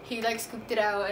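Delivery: ordinary talk, nothing marked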